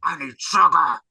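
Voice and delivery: loud, strained alien demand. Retold or imitated. [name] craving candy, loud Edgar voice. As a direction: rasp it like the bug in a man suit.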